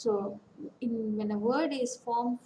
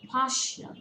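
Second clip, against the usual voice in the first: Partial with a louder, higher PAR; stress on first syllable; 4 semitones higher, 4 dB louder than usual. Shown as a demonstration. Teacher repeating the example, first syllable stressed. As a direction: hit PAR in Partial, let the rest drop. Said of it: "Stress falls on the first syllable".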